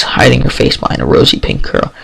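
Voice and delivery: ordinary delivery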